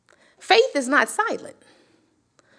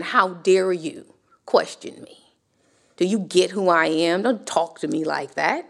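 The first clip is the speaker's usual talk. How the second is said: hushed angry God; 7 semitones lower than usual. Retold or imitated. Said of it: "God being very angry".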